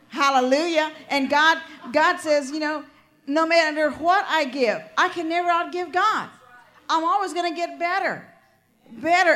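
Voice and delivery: loud, high, fervent preaching; preaching at full force. Acted. Preacher rousing the congregation, loud fervent preaching. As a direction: build the praise to a shout.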